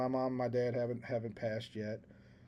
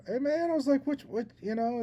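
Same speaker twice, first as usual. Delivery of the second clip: high startled retelling; surprised high voice; 11 semitones higher than usual. Retold or imitated. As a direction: pitch up, startled and eager.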